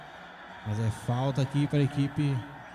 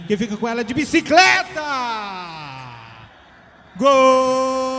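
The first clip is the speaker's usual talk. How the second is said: screaming the goal call, high and loud; erupt, fast and loud, at the goal; explosive goal commentary; 7 semitones higher, 7 dB louder than usual. Real, not acted.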